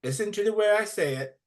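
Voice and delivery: high, preachy teacher voice drilling pupils; boarding school teacher. Acted. Teacher drilling native children, high and preachy. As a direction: lecture the class like a zealous teacher. Playwright reads teacher monologue.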